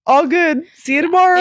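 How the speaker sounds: breezy all good. Retold or imitated. Cheerfully waving off lost dress.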